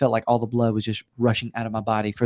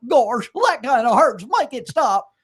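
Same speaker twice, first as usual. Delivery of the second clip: higher, slow dopey cartoon dog voice; Goofy; 9 semitones higher, slower than usual. Patient in bone marrow biopsy answers as a slow dopey cartoon dog. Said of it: "Hey, Goofy".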